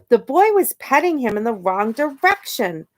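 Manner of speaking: ordinary delivery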